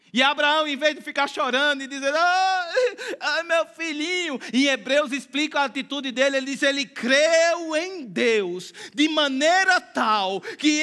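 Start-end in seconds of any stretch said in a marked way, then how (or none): 2.2-4.4 hammed-up whimpering wail; mock crying. Preacher mocking a crying Abraham, hammed-up sob. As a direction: fake a weepy lament. Abraham whining, not what he did.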